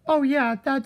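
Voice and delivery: normal talking voice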